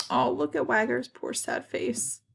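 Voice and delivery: ordinary delivery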